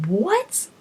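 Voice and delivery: high rising startled what; just woken and lost; confused what. Retold or imitated. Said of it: "you're just like".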